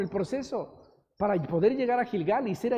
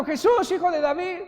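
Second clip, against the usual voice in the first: higher, calling out again; retold shout; 7 semitones higher, 4 dB louder than usual. Beggar still crying out to Jesus, high call. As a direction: keep crying out his name. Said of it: "Y él seguía gritando".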